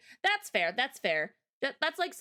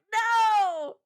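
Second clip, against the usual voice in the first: loud, high protesting no; 10 semitones higher, 7 dB louder than usual. Real, not acted. Chat jokes about a gambling arc, loud protesting no. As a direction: shout it down with a laugh. Reacting to chat's joke.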